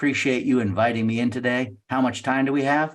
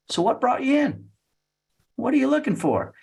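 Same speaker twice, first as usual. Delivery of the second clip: high-pitched, curious opener; curious questioning; 12 semitones higher than usual. Acted. Salesperson opens up a prospect, high and curious. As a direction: ask lightly, way up high.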